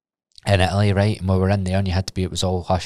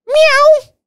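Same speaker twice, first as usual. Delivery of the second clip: imitated lady's meow; 10 dB louder than usual. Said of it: "She has just literally went".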